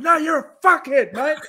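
high, laughing mock rage at the cops; mock angry outburst. Cameraman swearing at French cops, high mock rage. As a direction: squeal it out like a riled-up Aussie mate. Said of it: "Emu started arcing up, going".

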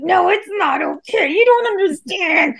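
high-pitched panicked protest, reenacted; acted freaking out. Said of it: "freaking out".

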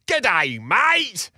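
hammed-up Australian greeting, swooping pitch; bad Aussie accent. Boss calling to Aussie landscapers from the window, bad Aussie accent. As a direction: put on a terrible Aussie accent. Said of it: "in a pretty shit Aussie accent".

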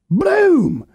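sharp high "Boom!" imitating a crash; vocal crash effect; imitated boom. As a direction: burst out with the sound of a door slam. Dad crashing through door.

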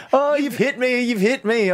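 high mock cry of you've hit me; a hint of a melodramatic victim; mocking dramatic victim. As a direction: overplay the injured old woman.

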